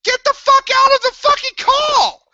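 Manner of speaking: screaming high and loud at friends; real angry high yell; furious shout. Real, not acted. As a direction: yell them out of the call. Yelling at friends in call.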